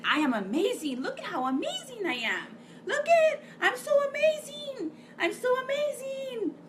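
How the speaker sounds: high, sing-song bragging, pitched well up; boastful show-off voice. Retold or imitated. Mocking a bragger who preens to the crowd. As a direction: preen like a show-off admiring herself. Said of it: "someone that's saying".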